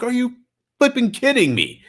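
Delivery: half-laughing can't-believe-it; retelling the moment again with a grin; incredulous. Retold or imitated. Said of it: "I'm like".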